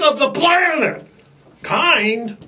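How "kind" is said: ordinary delivery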